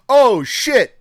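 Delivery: sudden very high, loud outburst; startled exclamation. Retold or imitated. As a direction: blurt it out in shock at the shoes. Stranger spots the shoes.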